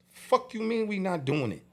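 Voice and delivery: retold outraged challenge, pitched high; indignant disbelief. Label boss challenges manager over cancelled show, indignant. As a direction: throw the refusal back, incredulous. Acted argument with manager refusing gig.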